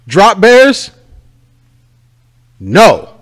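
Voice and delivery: high, loud punchy slogan; mock sign slogan. Reading out the joke anti-drop-bear sign, loud and punchy. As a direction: announce it like a bold sign.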